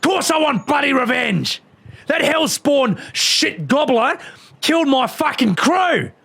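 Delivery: screaming furious pirate queen, pitched high; furious shout. Acted. Pirate queen screams at the cat about revenge, furious high yell. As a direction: rage at the cat for killing her crew.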